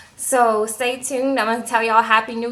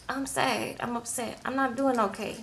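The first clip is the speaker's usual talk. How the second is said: put-on much softer, a shy little boy murmuring; small sad kid voice; 12 dB quieter than usual. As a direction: play the sad little boy, barely audible. Little Leo answers grandma, sad.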